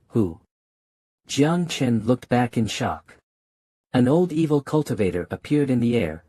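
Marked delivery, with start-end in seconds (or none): none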